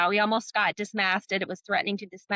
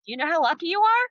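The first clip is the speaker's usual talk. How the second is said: mentor's question; 8 semitones higher, 3 dB louder, faster than usual. Retold or imitated. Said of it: "he goes".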